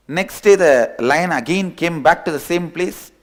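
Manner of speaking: ordinary talk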